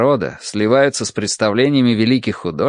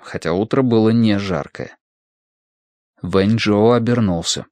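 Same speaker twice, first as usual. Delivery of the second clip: usual speaking voice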